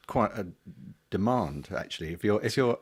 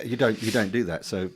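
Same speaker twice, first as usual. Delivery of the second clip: normal talking voice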